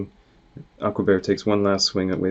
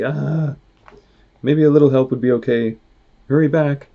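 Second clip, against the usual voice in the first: higher, louder, panicky plea for help; scared plea; 6 semitones higher, 7 dB louder than usual. Acted. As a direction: beg them to hurry back. Frank scared as bear shakes tree.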